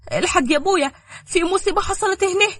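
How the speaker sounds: breathless with bad news; anxious daughter. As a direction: daughter panicking on the phone. Daughter reports disaster.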